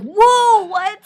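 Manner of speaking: high, loud startled whoa; a jolt of disbelief, voice jumping up; shocked whoa. Retold or imitated. Teacher shocked reaction.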